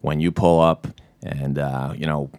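put-on a real Boston accent in a low, easy movie-scene voice; natural Boston accent. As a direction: deliver it like a Southie friend speaking from the heart. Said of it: "who just nail it".

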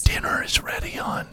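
very soft whisper into her ear. Pretend. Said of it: "whispering into their ears".

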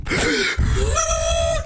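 high, wailing fake sob; mock crying wail. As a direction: burst into a hammed-up sob. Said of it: "just horrible crying".

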